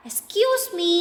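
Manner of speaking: put-on high little-child voice; a teacher voicing the elephant child to the crocodile; polite elephant child. A narrator's reading. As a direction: ask sweetly like a small child. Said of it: "asked the elephant child most politely".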